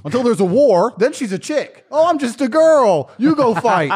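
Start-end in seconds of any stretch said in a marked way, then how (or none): 1.9-3.9 high, loud, drawn-out mimicry; mock helpless girl. Mimicking a woman who dodges war, mock helpless girl. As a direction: put on a hammed-up girly plea.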